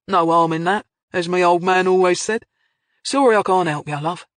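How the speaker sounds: put-on Cockney accent. A narrator's reading. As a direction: brush them off in easygoing Cockney. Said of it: "last vestiges of a lingering Cockney accent".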